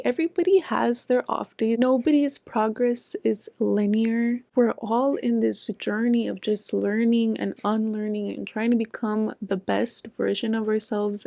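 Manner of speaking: usual speaking voice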